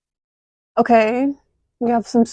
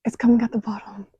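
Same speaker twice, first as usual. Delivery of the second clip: whispered, a bit quicker; awed whisper; faster than usual. Real, not acted. Watching smoke pour down, whispering to herself in awe.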